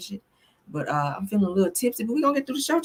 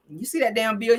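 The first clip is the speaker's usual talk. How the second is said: ordinary talk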